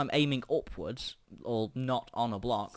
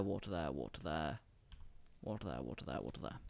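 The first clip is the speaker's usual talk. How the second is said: trailing off, softer and lower each time; fading mutter; 7 semitones lower, 10 dB quieter than usual. Real, not acted. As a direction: murmur it to yourself, fading away. Repeats while placing water.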